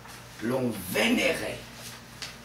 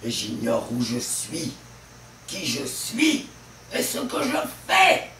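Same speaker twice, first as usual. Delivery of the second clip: Harpagon's bewilderment; 5 dB louder than usual. Acted. Miser finds he has seized himself, bewilderment building to panic. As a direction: build from dazed realisation to loud panic. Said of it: "troublé, se saisisse lui-même".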